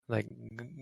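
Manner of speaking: normal talking voice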